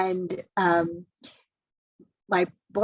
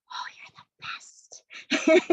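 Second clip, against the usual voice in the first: retold whisper; 8 semitones higher, much slower than usual. Boyfriend's tender reply.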